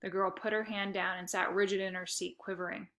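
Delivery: plain reading voice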